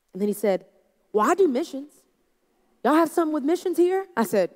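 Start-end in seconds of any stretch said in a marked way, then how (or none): 1.1-4.1 higher, louder, eager question; excited visitor. Retold or imitated. Relieved visitor asking the pastor about missions, higher and eager. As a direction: perk up at the good news. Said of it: "He's so excited".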